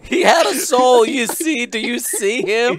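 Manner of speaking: high, loud mock-impassioned plea; hammed-up, high-pitched insistence; mock outcry. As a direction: cry out in playful protest. Mock outcry over dead Skippy.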